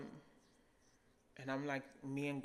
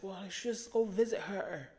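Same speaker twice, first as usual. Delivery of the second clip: higher, mock-casual advice voice; lightly mimicking preachy relatives; mocking people; 5 semitones higher than usual. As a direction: parrot the easy advice of others. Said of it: "people are just like".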